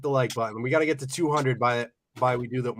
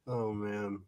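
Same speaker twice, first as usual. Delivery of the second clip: ordinary delivery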